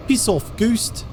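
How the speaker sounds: high mock New Zealand accent; imitated Kiwi accent. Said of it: "a Kiwi advert from back in the day".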